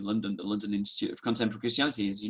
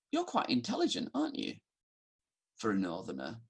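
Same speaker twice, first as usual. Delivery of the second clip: put-on upper class English accent; 3 semitones lower, 5 dB quieter, faster than usual. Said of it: "he had a really upper class accent".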